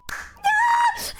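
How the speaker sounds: high exasperated yelp; frustrated high yelp. Real, not acted. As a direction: let out a sharp high shriek of frustration. Frustrated outburst mid-story.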